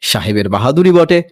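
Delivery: plain reading voice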